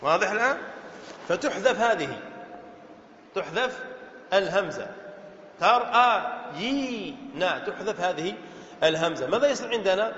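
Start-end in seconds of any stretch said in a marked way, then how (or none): 5.6-7.4 very slow, drawn-out "ترأيين"; syllable by syllable. Shown as a demonstration. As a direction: separate and stretch every syllable. Teacher dissects the word form. (تقطيع الكلمة ببطء شديد)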